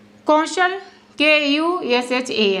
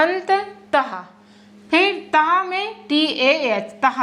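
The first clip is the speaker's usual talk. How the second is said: normal talking voice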